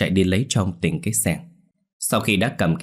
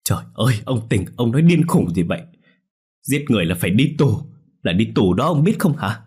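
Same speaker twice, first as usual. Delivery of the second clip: louder, higher wailing plea; sobbing plea; 3 semitones higher, 3 dB louder than usual. Acted. Wife begs her husband, a mild sobbing wail. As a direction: cry out at him, voice climbing. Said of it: "Bà Hải nức nở".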